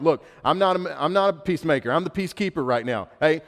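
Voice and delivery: a fed-up father giving up on keeping peace; a father yelling at his fighting boys in mock exasperation; exasperated dad. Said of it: "I look at my boys and I'm like".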